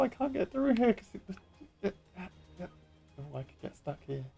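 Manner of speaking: ordinary delivery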